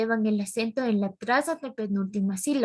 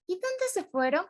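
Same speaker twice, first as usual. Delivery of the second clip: ¿Y dónde se fueron? pitched much higher but softer; exclaiming tune; 7 semitones higher, 4 dB quieter than usual. Shown as a demonstration. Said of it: "oración exclamativa".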